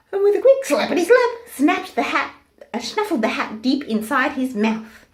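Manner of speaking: loud, high, building excitement; excited narration. A narrator's reading. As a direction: tell the snap with thrill. Exciting crocodile snap.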